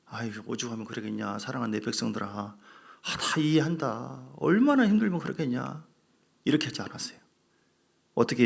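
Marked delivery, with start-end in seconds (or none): 0.1-5.8 much softer, gentle comforting voice; tender consoling God. Pretend. God comforting his grieving people, soft and tender. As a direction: soothe them like a loving father, softly. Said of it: "하나님께서 뭐라고 했습니까".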